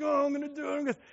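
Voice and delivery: put-on high, strained little-boy voice; a kid heaving with effort; boy trying to make his dad proud; straining boy. Boy straining to lift log.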